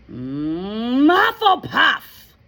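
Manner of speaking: drawn-out high, loud announcement; hum, then cry out the house slowly; shouting hat. Acted. The Sorting Hat announces Hannah's house, shouting slowly. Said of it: "shouted the hat".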